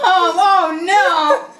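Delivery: mock dismay.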